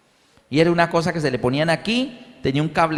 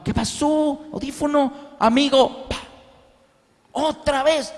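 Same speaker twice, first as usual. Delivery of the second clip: much higher, muffled childlike imitation; deaf child's speech; 9 semitones higher, slower than usual. Brother explaining the broken hearing aid, muffled child voice. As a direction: voice the deaf boy's broken words.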